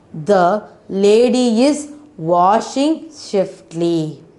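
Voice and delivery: put-on slow, clear sentence for children; slow model reading. Shown as a demonstration. Teacher reading the sentence slowly for her pupils. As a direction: read it slowly, word by word. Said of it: "teacher models sentence for children".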